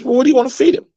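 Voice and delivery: squeaky high cartoon pig agreeing; high-pitched pig agreeing to breakfast. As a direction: voice the pig way up high, going along with it. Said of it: "pig says".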